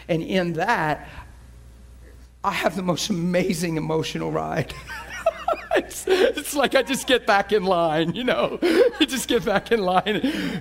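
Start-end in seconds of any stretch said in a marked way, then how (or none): 4.8-10.6 slow, giggling delivery; can't stop laughing while talking; laughing through words. Real, not acted. Pastor delighting the congregation, laughing through it. Laughing about life's joy.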